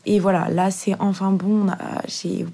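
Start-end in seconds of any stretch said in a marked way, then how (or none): 1.6-2.5 stumbling stutter on the words. Real, not acted. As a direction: trip over the words, stuttering. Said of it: "ah, je bégaye".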